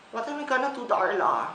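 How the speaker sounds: normal talking voice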